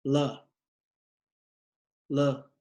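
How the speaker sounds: "L" said as its sound, "luh"; isolated letter sound. Shown as a demonstration. Teacher giving the word's first sound, "L" as "luh". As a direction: sound out "L" as a short "luh", pausing between repeats. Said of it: "the L sound".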